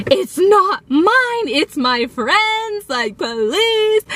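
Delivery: high, drawn-out whiny wail; mock crying. Teen sobbing to parents it's not hers, mock crying. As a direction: plead in a hammed-up sobbing whine. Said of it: "I was, like, crying and sobbing".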